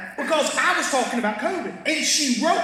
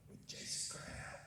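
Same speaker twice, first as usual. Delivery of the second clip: awed whisper; 27 dB quieter than usual. Acted. Awed inner thought.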